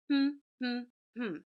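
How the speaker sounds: three short separate hums; closed-mouth hums, evenly parted; humming. Shown as a demonstration. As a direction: hum one beat for each syllable. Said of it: "Hum that with me".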